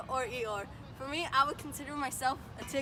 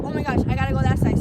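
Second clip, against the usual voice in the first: loud and fast, rushed panic; panicked; 3 semitones higher, 13 dB louder, faster than usual. Retold or imitated. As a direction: blurt it out in a hurry. Retold panicked thought mid-game.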